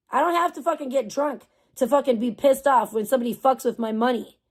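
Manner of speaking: usual speaking voice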